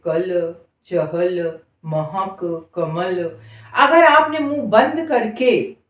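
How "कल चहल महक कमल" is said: "कल", "चहल", "महक", "कमल" read slowly and low; slow word list. Shown as a demonstration. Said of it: "बोलकर देखिए". (शब्दों को धीरे-धीरे पढ़कर दिखाना)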